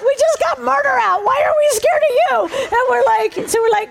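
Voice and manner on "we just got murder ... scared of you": incredulous. Retold or imitated. Group's bemused retort to the spirit.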